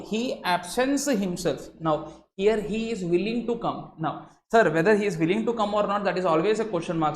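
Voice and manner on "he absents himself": slow he absents himself, absents falling; stress on absents. Shown as a demonstration. Lecturer showing the willing-to-come reading, slow stress. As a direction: dwell on absents, slowly. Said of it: "if you use this tone".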